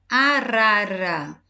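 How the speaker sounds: slow, syllable by syllable arara; carefully spelled-out arara; teaching word. Shown as a demonstration. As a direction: say arara slowly, stretching each syllable. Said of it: "No R no início, no R brando".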